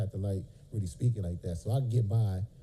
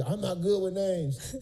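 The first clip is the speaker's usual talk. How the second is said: slow, high mocking excuse; mock a lazy excuse; mocking whiny excuse; 8 semitones higher, slower than usual. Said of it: "If you".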